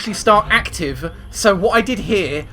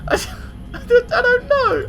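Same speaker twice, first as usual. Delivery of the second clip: high, breathy laughing stammer; real helpless laughter; laughing helplessly. Real, not acted. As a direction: giggle through the words, lost for what to do. Stammering after failed shot.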